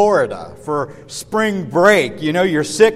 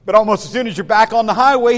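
ordinary talk, nothing marked

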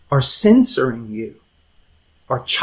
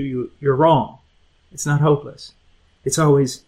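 ordinary talk

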